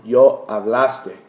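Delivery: yo hablaste spelled out slowly and loud; slow teacher model of hablaste; slow demo. Shown as a demonstration. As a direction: say each syllable of hablaste slowly for students. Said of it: "The tu form".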